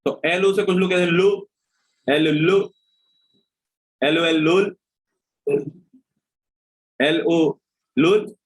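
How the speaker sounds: usual speaking voice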